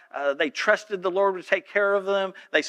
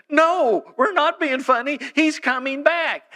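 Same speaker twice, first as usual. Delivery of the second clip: alarmed insistence; 9 semitones higher, 4 dB louder, slower than usual. Retold or imitated.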